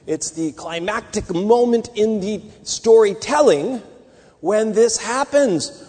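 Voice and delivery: normal talking voice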